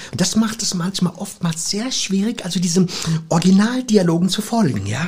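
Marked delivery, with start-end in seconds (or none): none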